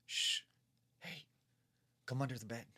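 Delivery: lightly acted hushing summons; a hissed shush, then a lightly voiced beckoning; mysterious man's voice. Unseen man under the bed beckoning the mother, mysterious voice. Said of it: "it's, like, a man's voice".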